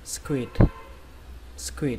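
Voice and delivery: squid ending on an audible D; "squid" with a released d at the end; final D sound. Shown as a demonstration. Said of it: "kết thúc bằng âm D".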